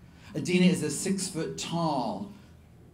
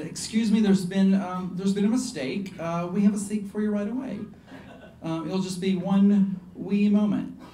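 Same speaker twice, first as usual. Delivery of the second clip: higher, hesitant polite hostess voice; high Southern voice; 5 semitones higher than usual. Retold or imitated. Manager seating a star, high Southern voice. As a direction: pitch it up, politely fumbling. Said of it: "I spoke with a really high voice".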